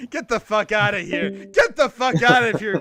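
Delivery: loud, high outburst; exasperated shout. Real, not acted. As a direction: yell it in disbelief to cut off the rant. Mock outburst after long rant.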